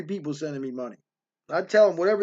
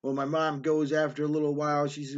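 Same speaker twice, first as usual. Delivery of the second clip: ordinary delivery, nothing marked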